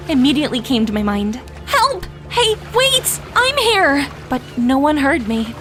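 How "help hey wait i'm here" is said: high, desperate shouting; calling for help. Acted. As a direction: yell after the sailing yacht. Overboard, calling to the yacht.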